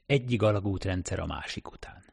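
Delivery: plain reading voice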